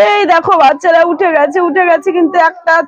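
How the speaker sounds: ordinary talk, nothing marked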